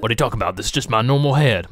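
usual speaking voice

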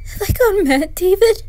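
panicked cry. Acted. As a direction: break out in panic. Panicking in closet.

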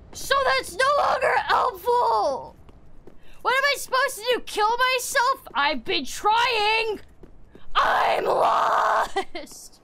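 high, stretched-out frustrated yells; high-pitched, dragged-out despair; exasperated shouting. Real, not acted. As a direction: wail and shout in a fed-up tantrum. Frustrated rant at being lost.

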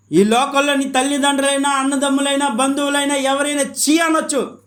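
high, driving preacher's voice; full-force preaching. Acted. Preacher warning that even family may reject you, impassioned preaching.

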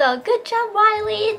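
put-on high cheerful call to a child; retold shout. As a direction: call out praise across the court. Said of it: "っていう風に叫んでたんですけど".

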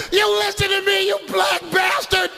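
high, yelled insult; high shrieking woman's rage; furious shout. Retold or imitated. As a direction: bark it at him with venom.

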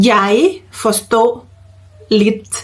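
slow model phrase with a pause after each word. Shown as a demonstration. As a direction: say each word separately for learners. (พูดช้าๆ ทีละคำ)

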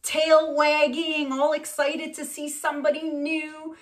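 high, drawn-out puppy glee; mock excitement. Telling how the dog greeted a stranger, mock excitement. Voices the dog's delight, mock-gushing.

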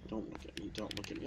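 whispered pleading; mock whisper. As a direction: hush it like hiding. Imitating sneaking game.

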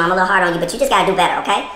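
normal talking voice

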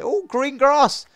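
high, loud, drawn-out delight; overexcited. Real, not acted. Gamer spots some grass, absurdly excited. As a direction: get carried away, stretching the words. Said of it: "I'm getting so excited about nothing".

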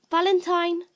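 calling the dog again. Real, not acted.

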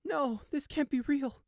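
high, slow cry of denial; distraught disbelief. A narrator's reading. Sheep sees his mother's body, distraught. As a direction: refuse it in a high, pained voice.

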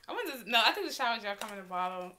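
ordinary delivery

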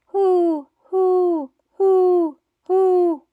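high, loud owl hoots; hooting owl for the class. Shown as a demonstration. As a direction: call out hoo hoo like an owl. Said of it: "He can sound like an owl".

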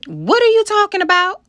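loud, high-pitched incredulous question; shocked, high-pitched challenge to a friend; incredulous outburst. A narrator's reading. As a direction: blurt it out high and loud in disbelief. Acted quarrel with friend in story.

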